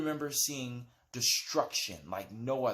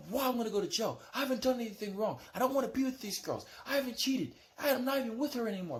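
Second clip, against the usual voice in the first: higher, fast pleading protest; frantic protest; 9 semitones higher, faster than usual. Retold or imitated. He pleads with the guard that he did nothing, higher fast protest. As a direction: protest your innocence in a rush. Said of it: "I'm like".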